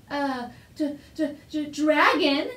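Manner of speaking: startled stammer that bursts out loud on the last word. A narrator's reading. Father hearing of the dragon, startled stammer. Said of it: "stammered his father".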